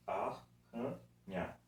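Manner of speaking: "Arranha" slowly as ar, ra, nha; syllable by syllable. Shown as a demonstration. Teacher counting three syllables, "Arranha" split slowly. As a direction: split "Arranha" between the two Rs. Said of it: "arranha também três sílabas".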